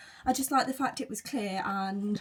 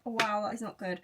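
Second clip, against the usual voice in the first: ordinary talk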